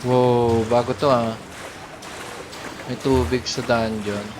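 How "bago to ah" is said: ordinary talk, nothing marked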